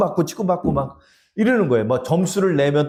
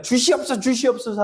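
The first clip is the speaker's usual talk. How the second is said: higher, whiny begging prayer; mock whiny begging; 7 semitones higher than usual. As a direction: beg like a greedy child. Mocking greedy prayer again.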